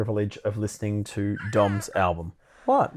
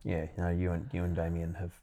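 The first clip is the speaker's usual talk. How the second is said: sincere, soft praise; 6 semitones lower, 8 dB quieter than usual. Real, not acted.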